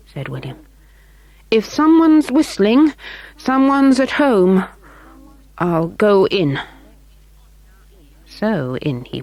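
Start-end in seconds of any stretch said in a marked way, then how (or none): 1.5-6.6 put-on higher, eager boy asking politely; a small boy's polite voice. A narrator's reading. William voiced by narrator.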